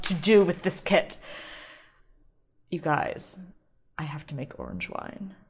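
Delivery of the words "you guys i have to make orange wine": whispered, much softer confession; secret whisper. Real, not acted. Host confides her wine idea to listeners, hushed whisper. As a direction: lean in and whisper the big plan.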